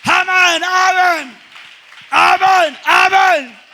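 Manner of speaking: loud, high shouted climax; preaching at full force. Acted. Preacher shouting the miracle to the congregation, full force. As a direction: build it to a roar of praise.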